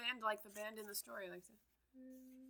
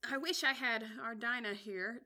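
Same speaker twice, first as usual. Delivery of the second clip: aloud; 3 semitones higher, 7 dB louder, slower than usual. Acted. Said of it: "said Alice aloud".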